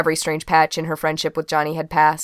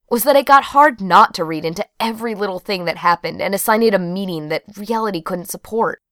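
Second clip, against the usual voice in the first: usual speaking voice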